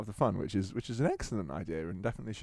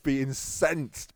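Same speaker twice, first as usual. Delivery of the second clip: normal talking voice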